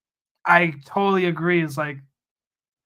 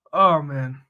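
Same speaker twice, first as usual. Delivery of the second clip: normal talking voice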